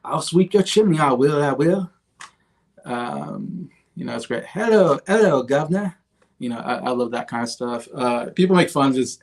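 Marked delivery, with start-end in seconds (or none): 0.1-1.9 higher, louder mock Cockney chimney sweep; Cockney impression. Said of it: "I always wanna go". 4.4-6.0 higher, louder hammed-up Cockney greeting; cheery mock Cockney hello; Cockney impression.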